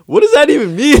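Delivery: high, loud, incredulous question; exaggerated confusion; mock bafflement. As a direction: mimic someone totally baffled. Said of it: "You're like".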